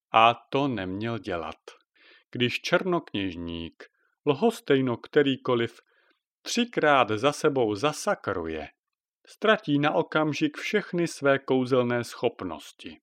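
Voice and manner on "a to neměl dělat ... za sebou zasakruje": plain reading voice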